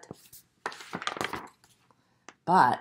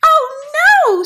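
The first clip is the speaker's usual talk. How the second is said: high, loud piglet scream; 14 dB louder than usual. A narrator's reading. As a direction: cry out in storybook alarm. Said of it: "screamed the piglet".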